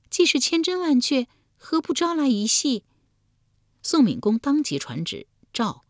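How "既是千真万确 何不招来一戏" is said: very high, coaxing lady's voice; royal concubine's voice. Acted. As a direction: coax the duke in a high feminine voice. Concubine urges the duke, voiced high.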